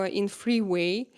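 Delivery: ordinary talk, nothing marked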